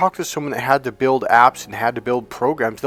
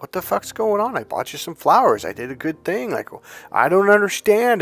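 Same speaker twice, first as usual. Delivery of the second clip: higher, baffled protest, mildly imitated; exasperated confusion; 7 semitones higher than usual. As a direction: throw up your hands, lost. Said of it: "and you're like".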